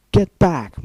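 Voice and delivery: loud barked command; yelled order. Acted. Suited guards driving the captives back, loud yell. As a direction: yell it at the ponies to get back. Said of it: "more suits yelled".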